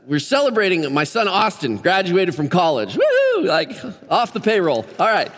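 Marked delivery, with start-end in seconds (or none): 3.0-3.4 high-pitched woo-hoo; playful high cheer; celebratory whoop. Pretend. Celebrating son's graduation from college.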